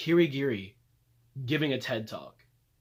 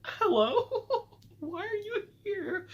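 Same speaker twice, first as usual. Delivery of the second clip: much higher, squeaky, laughing disbelief; shocked squeal; much slower than usual. Retold or imitated. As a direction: squeal it out like you can't believe your eyes. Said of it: "I said".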